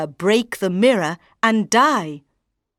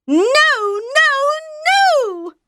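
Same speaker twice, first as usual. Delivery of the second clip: high, loud raging no; loud high-pitched tantrum; queen shouting; 4 dB louder, slower than usual. Acted. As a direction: scream it high in fury. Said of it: "shouted the queen".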